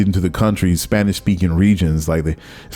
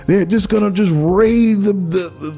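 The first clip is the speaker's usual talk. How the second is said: much higher, panicky mock voice; mock alarmist propaganda; 10 semitones higher than usual. Border propaganda about the caravans coming, mock panic. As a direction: imitate scaremongers raising a false alarm. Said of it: "the propaganda was going on".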